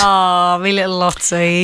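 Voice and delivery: put-on low, creepy baby talk to the cat; creepy pet voice. Host baby-talking her cat in the car, creepy low coo. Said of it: "the weirdest voice I've ever heard".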